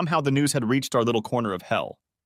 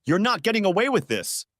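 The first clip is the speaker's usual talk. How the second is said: higher, spat-out angry threat; 10 semitones higher than usual. A narrator's reading. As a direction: spit the words at him. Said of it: "he spat".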